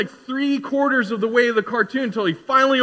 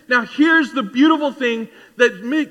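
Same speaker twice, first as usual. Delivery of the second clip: ordinary talk, nothing marked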